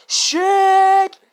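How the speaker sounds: loud, high, drawn-out cheer; belted out with hype; hyped shout. Retold or imitated. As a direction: stretch it into one long hyped-up yell. Hyping up a roast.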